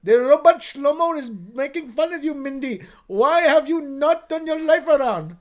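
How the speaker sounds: put-on loud, high scolding parent in an Indian accent; hammed-up Indian-accented scolding. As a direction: berate like an outraged Indian parent.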